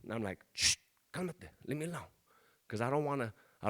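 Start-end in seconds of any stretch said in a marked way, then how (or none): 0.5-2.1 soft, half-voiced shushing; hushed brush-off. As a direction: shoo someone off quietly. High dad shushing his little son.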